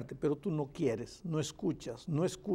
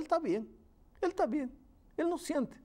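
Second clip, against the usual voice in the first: much higher pitch, at usual volume; oblivious sinner's view; 11 semitones higher than usual. Acted. Preacher explains how the unrepentant sinner feels fine, high-pitched obliviousness. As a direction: lift the voice high for the self-excusing sinner.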